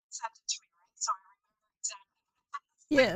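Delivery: thin, tinny, far-off voice over a bad line; tin can sound. Real, not acted. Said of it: "you're starting to sound like you're in a little tin can".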